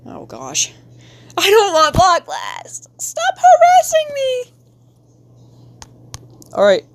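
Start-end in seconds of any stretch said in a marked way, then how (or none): 0.1-4.5 a high, loud shriek at the ad; mock-panicked shout. Real, not acted. Streamer shrieking at a pop-up ad in her game. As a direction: yell in exasperation.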